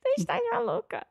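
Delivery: high, quick mock Portuguese accent. Wife echoing husband's Portuguese impression.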